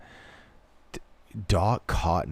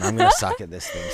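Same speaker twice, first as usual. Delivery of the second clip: exasperated squeal; 4 dB louder, faster than usual. Real, not acted. Frustrated at failing the game.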